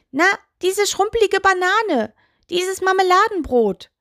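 high, teasing toe character voice; cheeky taunting. A narrator's reading. Toe mocks the witch to the doll, cheeky. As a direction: toss out silly insults in a high voice.

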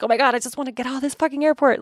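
desperate to get out; panicked frustration. Retold or imitated. Stuck at customs with a line behind, panicked. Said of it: "a little bit panicked".

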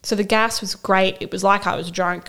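ordinary talk, nothing marked

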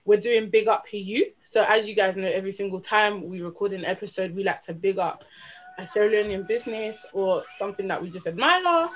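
usual speaking voice

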